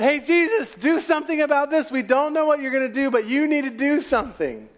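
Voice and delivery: high, urgent cry for help over the storm; panicked plea. Acted. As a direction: plead with Jesus, rising to a near yell.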